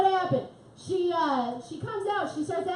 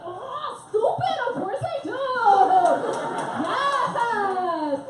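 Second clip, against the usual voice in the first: high, loud squeal of excitement; ecstatic acceptance; 7 semitones higher, 7 dB louder, much slower than usual. Retold or imitated. Comic telling friend she'll meet J-Lo, ecstatic. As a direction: burst out with a high, thrilled yes. Acts out thrilled answer to friend.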